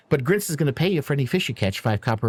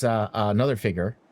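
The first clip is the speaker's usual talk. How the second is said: usual speaking voice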